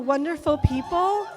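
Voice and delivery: loud, excited toast finale; joyful shout. Real, not acted. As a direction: shout it out to the crowd. Toast climax, shouting love.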